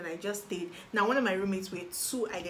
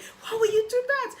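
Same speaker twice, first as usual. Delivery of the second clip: mock outrage; 7 dB louder than usual. Scolding herself for changing choices.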